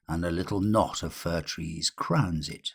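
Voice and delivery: ordinary delivery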